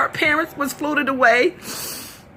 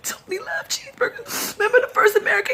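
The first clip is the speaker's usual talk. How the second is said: crying; 6 semitones higher, 3 dB quieter, faster than usual. Real, not acted. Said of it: "That's why I'm so emotional".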